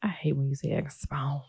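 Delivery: hushed unvoiced whisper. Real, not acted. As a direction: whisper it as an aside. Whispered aside.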